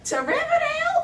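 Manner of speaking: very high, loud questioning voice; exaggerated dispatcher squeal of confusion; confused operator. Operator mishears girl's town, comically high confusion. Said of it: "She goes".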